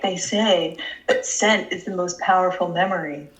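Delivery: low mock Dawson. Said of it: "Dawson was like".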